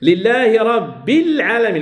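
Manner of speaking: very slow, "رب العالمين" as rabbil-alamin; linked on kasra. Shown as a demonstration. Teacher demonstrating the kasra link, "رب العالمين" drawn out as rabbil-alamin. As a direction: join "رب" to "العالمين" through i, no hamza. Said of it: "الكسرة".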